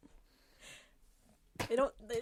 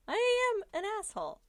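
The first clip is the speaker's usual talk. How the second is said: excited; 10 semitones higher, 6 dB louder than usual. Real, not acted. Repeats it higher, laughing.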